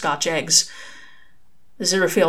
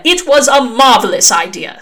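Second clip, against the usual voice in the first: louder, higher sharp snap; snapping; 6 semitones higher, 10 dB louder than usual. Acted. Angel snaps at Crowley over the idea, loud and sharp. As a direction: snap back, indignant. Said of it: "snapped Aziraphale".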